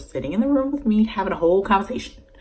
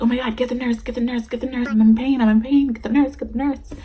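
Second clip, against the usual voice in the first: high, loud repeated cries for help; panicked pleading; 7 semitones higher, 6 dB louder, faster than usual. Retold or imitated. Patient in pain to her family, loud panicked pleas. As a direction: beg them in a rising panic. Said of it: "So I'm like".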